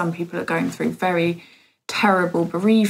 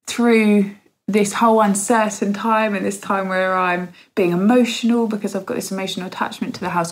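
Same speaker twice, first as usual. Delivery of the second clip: ordinary delivery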